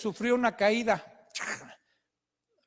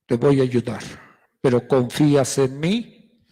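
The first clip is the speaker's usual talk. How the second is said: voice of God; 9 semitones lower, 6 dB louder than usual. Acted. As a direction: test his trust like God from above. God questions the climber.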